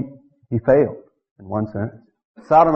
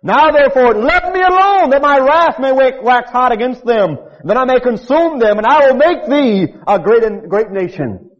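high, loud thundering scripture; upset God rebuking his people; preaching at full force; 10 semitones higher, 5 dB louder than usual. Acted. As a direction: bellow God's wrath from the pulpit.

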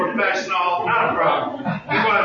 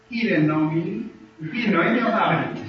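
ordinary talk, nothing marked

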